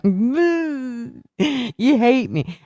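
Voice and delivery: high, slow, whiny pout; wife mocking her own weepy drunk self to a friend. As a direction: sulk like a self-pitying drunk. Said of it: "then it was like".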